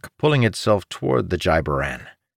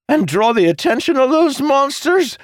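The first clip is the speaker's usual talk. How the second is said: much higher, louder, incredulous outburst; blurting Jacob; 5 dB louder than usual. A narrator's reading. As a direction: blurt it out in alarmed disbelief. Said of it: "Jacob blurted".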